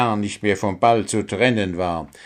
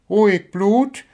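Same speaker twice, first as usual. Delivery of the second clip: lightly voiced high owl, appeasing; gently mock-calming, pitched high; Agathe, soothing; 12 semitones higher, 4 dB louder than usual. A narrator's reading. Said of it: "beschwichtigte Agathe, die kluge Eule".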